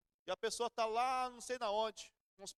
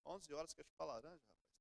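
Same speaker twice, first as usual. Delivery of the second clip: inner grumble; 5 semitones lower, 10 dB quieter than usual. Retold or imitated. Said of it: "Pensei, não falei".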